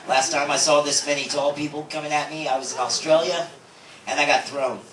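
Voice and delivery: usual speaking voice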